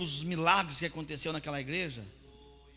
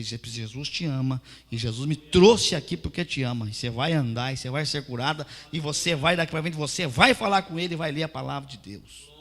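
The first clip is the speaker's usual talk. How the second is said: fervent loud prayer; 9 dB louder than usual. Acted. Pastor praying loudly over the crippled woman, fervent. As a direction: cry it out over her legs.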